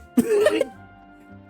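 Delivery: high-pitched burst of giggles; snickering laugh. Real, not acted. Streamer proposing a sock as actress, cracking up.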